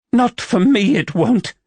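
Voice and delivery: high, loud, choked-up protest. A narrator's reading. Heroine choking out her refusal to him, high and loud. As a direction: force it out past a tight throat. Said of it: "she choked out".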